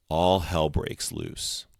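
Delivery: ordinary delivery, nothing marked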